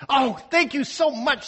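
high, bright gushing thanks; excited thanks. Acted. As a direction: gush it out, delighted. Excited girl thanks godmother.

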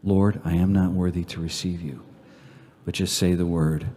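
low, soft, reverent prayer; quiet devotional prayer with long pauses. Real, not acted. Leads closing prayer.

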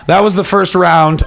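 ordinary talk, nothing marked

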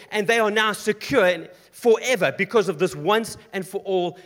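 high, loud, driving sermon; full-force preaching. Acted. Preacher building to the climax before the congregation, loud.

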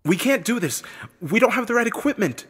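high-pitched urgent objection; protesting woman, voice pitched well up; arguing. A narrator's reading. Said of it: "Celeste argued first".

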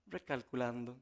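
soft, unbothered machine voice; the GPS calmly reroutes a lost driver, soft and even. Retold or imitated. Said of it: "esa vocecita que te da esa tranquilidad".

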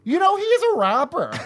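high, loud airheaded mom; ditzy mom voice. Retold or imitated. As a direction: play his scatterbrained mother. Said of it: "Your mother does not sound like that".

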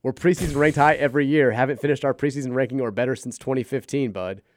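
ordinary talk, nothing marked

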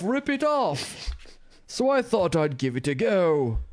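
high, loud, drawn-out upper-crust English; a hammed-up upper-crust storyteller, voice pitched high; upper-crust British accent. Host as teen raver recounting the fire alarm, upper-crust accent. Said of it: "like, an upper crust, uh, type of accent".

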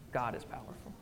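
soft reverent whisper, barely voiced. Real, not acted. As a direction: breathe it out in awe. Whispered repeat of key line.

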